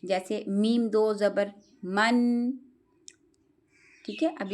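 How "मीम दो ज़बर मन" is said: मीम दो ज़बर मन spelled slowly, ending on a clear nasal मन; slow spelled-out demo. Shown as a demonstration. Teacher spells tanween for students, slowly. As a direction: spell each letter out slowly, landing on मन. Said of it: "वो इस तरह करें".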